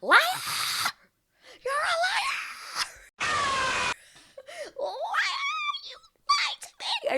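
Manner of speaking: shrieked, very high mock scream at the screen; screaming liar. Viewer screams liar at Jeremy on screen, mock shriek. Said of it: "I wanted to scream so badly".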